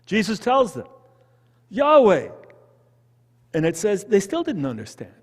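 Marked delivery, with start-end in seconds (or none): 1.7-2.3 proclaiming the name. Acted. Jesus names himself.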